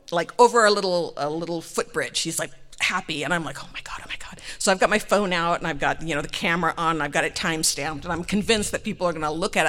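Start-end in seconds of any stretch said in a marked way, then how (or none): 3.6-4.4 hushed mock panic; nervous whisper. Wife frets about being seen, hushed whisper. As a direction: whisper it anxiously to yourself.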